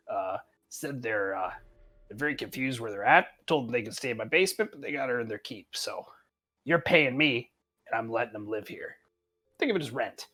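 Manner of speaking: low, soft mumbling goblin; quiet conspiratorial goblin. Acted. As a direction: lean in and grumble it quietly. GM voicing goblin.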